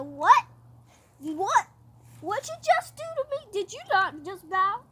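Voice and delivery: high, hammed-up indignation; affronted outrage. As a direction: play a slighted big shot demanding answers. Haman affronted at no bow.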